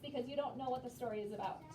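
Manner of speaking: usual speaking voice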